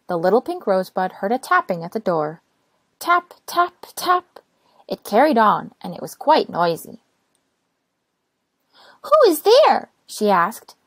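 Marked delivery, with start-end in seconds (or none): 3.0-4.4 high, light tap tap tap; a bright knocking chant; tapping sound. A narrator's reading. Rain tapping sound effect. 9.0-9.9 high, loud call through the door; little rosebud demanding who knocks. Acted.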